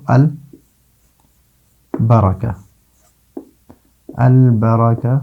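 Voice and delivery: البرکہ slowly, broken after a clear "al"; lam pronunciation demonstration. Shown as a demonstration. Teacher showing lam before ba, slow separated البرکہ. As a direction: draw out البرکہ as "al... baraka", lam fully sounded. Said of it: "لام کا تلفظ ہوتا ہے".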